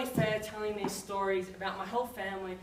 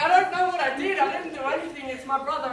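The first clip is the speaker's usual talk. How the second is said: panicked denial; 5 semitones higher, 7 dB louder than usual. Retold or imitated. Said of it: "I was like".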